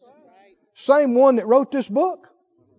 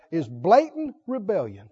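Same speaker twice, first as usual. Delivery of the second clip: ordinary delivery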